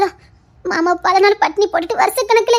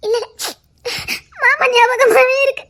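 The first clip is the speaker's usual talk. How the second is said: weeping; 4 semitones higher than usual. Acted. Aunt weeping for husband.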